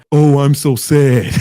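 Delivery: slow, loud mock wail; mock sad. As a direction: drag it out, mocking a mopey friend. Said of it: "saying some shit like".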